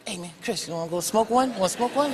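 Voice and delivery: hushed sneaky offer; mock hushed dealer voice; quiet. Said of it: "People come to me all quiet".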